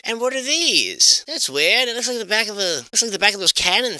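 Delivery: high, slowed puzzled wonder; surprised. Real, not acted. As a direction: react as if spotting something odd.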